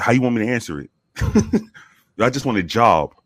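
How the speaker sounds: ordinary talk, nothing marked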